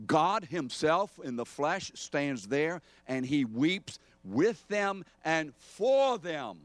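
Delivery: high, loud, drawn-out preaching; impassioned preaching. Real, not acted. Preacher telling believers how God weeps, impassioned and loud. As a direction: preach it slowly at full force, stretching the key words. Preacher rises to full force.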